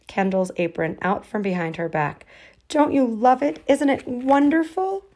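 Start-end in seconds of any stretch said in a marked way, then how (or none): 2.7-5.0 higher, louder eager questions. Acted. Girl showing off the apron, eager. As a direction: bubble over with excitement.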